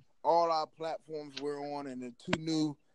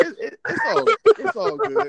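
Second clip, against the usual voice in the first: sudden very high, loud squeal; squeaky high voice; 11 dB louder than usual. Acted. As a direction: shoot way up into a squeaky falsetto. Mock reply after underwater remark.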